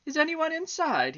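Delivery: high-pitched butterfly calling in; polite butterfly. A narrator's reading. Butterfly asking at the elephant's door, high-voiced. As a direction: call lightly up high through a door. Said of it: "he knocked very gently".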